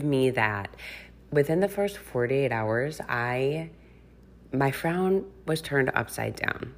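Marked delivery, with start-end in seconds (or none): none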